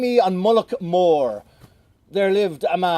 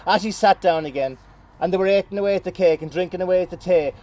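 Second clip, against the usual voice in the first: ordinary delivery, nothing marked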